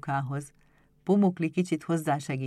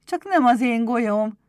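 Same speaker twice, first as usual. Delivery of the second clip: raised, loud cry of delight; joyful exclamation; 7 semitones higher, 10 dB louder than usual. A narrator's reading. Éder spots his marble, loud joyful exclamation. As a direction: exclaim high and loud in happy surprise. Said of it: "kiáltott fel Éder örvendezve".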